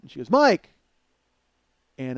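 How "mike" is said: sharp high shout, much louder; shouted call. Retold or imitated. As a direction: yell the name across the house. Retold girlfriend calling him.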